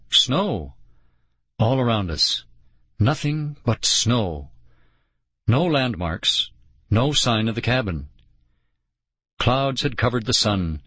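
ordinary delivery, nothing marked

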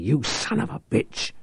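sudden angry burst; angry outburst. A narrator's reading. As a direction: spit it out in fury. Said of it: "Reich burst out".

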